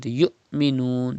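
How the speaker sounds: "yu'minun" with a glottal catch after "yu"; sudden Hamza stop. Shown as a demonstration. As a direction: stop short inside "yu'minun" before "minun". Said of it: "pronounced with sudden pause".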